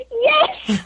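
high squealed retold answer; pitched-way-up mock shout; excited yell. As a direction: squeal it out on spotting a friend. Retelling excited answer to friend.

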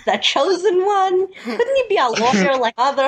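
high, kvetching Yiddish mother voice. Pretend. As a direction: play a fussing Yiddish mom.

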